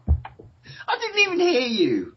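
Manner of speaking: very high, drawn-out squealed complaint; exasperated wail. Real, not acted. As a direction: wail it in comic despair. Host despairs at missed cue.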